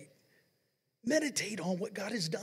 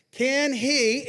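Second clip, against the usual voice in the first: higher, louder Can he; call again; 4 semitones higher, 8 dB louder than usual. Shown as a demonstration. Preacher calling out to the congregation for the answer. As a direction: throw the question to them. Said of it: "now watch it".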